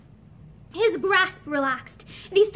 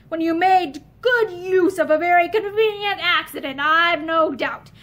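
sneered; 2 semitones higher, 4 dB louder than usual. A narrator's reading. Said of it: "Matherson sneered".

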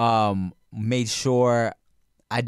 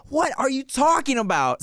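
high-pitched baffled parent; frustrated mom; 10 semitones higher than usual. Retold or imitated. Mom to her son she can't understand, frustrated. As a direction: imitate an exasperated mother, voice raised high. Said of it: "we were both getting so frustrated".

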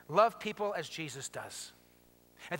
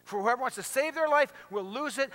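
ordinary delivery

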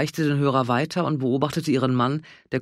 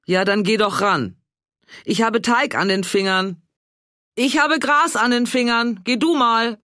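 raised, higher voices across the house; calling back and forth; 9 semitones higher, 4 dB louder than usual. A narrator's reading. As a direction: shout it over the distance. Ehepaar ruft sich durchs Haus. (Ehepaar ruft sich durchs Haus zu, laut und höher)

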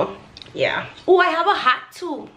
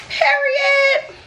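triumphant shouted catchphrase; shouted sassy period; 11 semitones higher than usual. Pretend. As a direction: belt it out like a final word. Shouted climax, far higher.

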